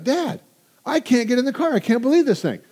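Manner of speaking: high-pitched, whiny daughter on the phone; imitated upset daughter. Daughter calling, car locked again.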